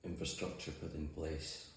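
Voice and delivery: ordinary talk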